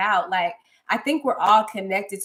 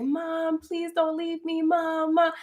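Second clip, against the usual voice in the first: put-on child crying for mom; 7 semitones higher, slower than usual. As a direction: replay a small child begging her mother not to leave, a touch tearful. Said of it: "I had memories of me crying".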